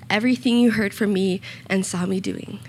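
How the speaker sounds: normal talking voice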